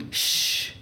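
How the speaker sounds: long voiceless "shh"; sustained shushing sound; hushing shh. A narrator's reading. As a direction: hush someone with a drawn-out breath. Character hushes the narrator.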